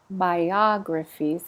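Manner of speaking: Biographies slow, stress on the second syllable; Biographies: say the "a" clearly and slowly. Shown as a demonstration. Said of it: "It's on the ah".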